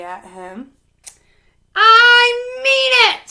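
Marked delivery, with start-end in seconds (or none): 1.7-3.3 slow, high, loud warning; threatening. A narrator's reading. Holler threatening the tornado, loud and slow. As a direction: make the storm believe you. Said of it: "he threatened".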